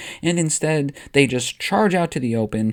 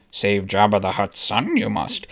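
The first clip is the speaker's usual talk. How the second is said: Yoda voice; 7 semitones lower than usual. Acted. Yoda giving orders.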